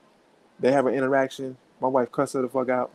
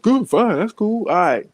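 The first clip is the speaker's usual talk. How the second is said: much higher, louder, quick backing down; imitating cowed neighbor; 8 semitones higher, 4 dB louder, slower than usual. Neighbor's son backing down, high hasty mock voice. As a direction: squeak out a hasty agreement. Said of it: "You like".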